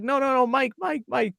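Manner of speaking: imitating a manager heading off trouble; urgent pleading. Club manager running down to stop Mike, urgent pleading.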